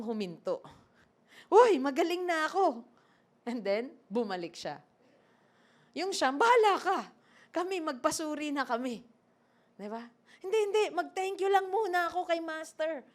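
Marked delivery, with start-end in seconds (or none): 1.5-2.8 high, loud joyful shout of a healed man; excited exclaim. Acted. Healed leper shouting to himself, excited and loud. 6.3-9.0 dismissive retort. Acted. Other lepers voiced in story. 10.4-13.0 insistent refusal. Acted. Grateful leper voiced in story.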